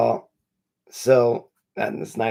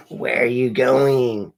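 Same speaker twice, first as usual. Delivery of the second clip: drawn-out, louder repeat of the question; mock-suspicious, drawn out; louder, slower retake; 2 semitones higher, 7 dB louder, slower than usual. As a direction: stretch it out and lean on "going". Repeats the quote more forcefully.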